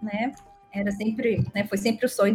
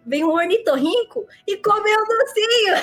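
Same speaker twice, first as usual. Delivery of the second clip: laughing; 9 semitones higher, 4 dB louder than usual. Real, not acted. Cracks up at own absurd story.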